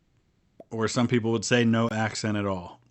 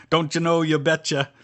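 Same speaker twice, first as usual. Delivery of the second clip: put-on higher, louder Minnesota accent; playful put-on Minnesota and Dakotas accent; 6 semitones higher, 5 dB louder than usual. Streamer shows a viewer the Dakotas talk, in Minnesota accent. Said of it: "that's Minnesota and the Dakotas for you".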